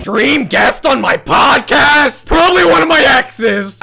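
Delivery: loud, high, slow snarky mimic; mocking [name]. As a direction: sneer her words back. Mimicking [name]'s clip.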